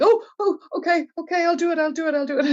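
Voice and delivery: very high, squeaky giving-in voice; comic high-pitched panicky compliance; flustered ovaries. Acted. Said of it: "And the ovaries are like".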